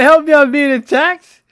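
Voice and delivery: high, loud imitation of the old man yelling for help; hammed-up yell, laughing along; mock cry for help. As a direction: squeal it like the old guy crying out. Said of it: "yo, this guy said".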